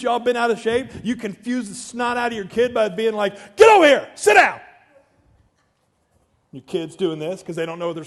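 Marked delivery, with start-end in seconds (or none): 3.6-4.6 high, very loud barked commands; angry parent shout. Acted. Emotional parent ordering a confused kid around, acted angry shouting. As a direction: yell it like a parent bent out of shape.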